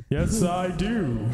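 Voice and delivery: put-on goldfish voice, breaking into a laugh; deep fish voice. Goldfish Jeremy repeats his answer in a deep put-on voice. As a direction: play the solemn fish again, half laughing. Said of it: "I had no idea you had such a deep voice".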